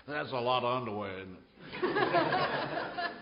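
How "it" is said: laughing squeak. Real, not acted. Laughing at underwear joke.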